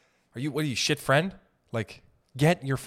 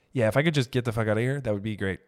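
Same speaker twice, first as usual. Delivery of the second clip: normal talking voice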